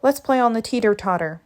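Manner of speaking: ordinary delivery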